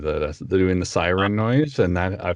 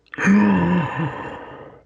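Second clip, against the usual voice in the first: high gasp; 11 semitones higher, 4 dB louder than usual. Retold or imitated. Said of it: "my Treebeard one".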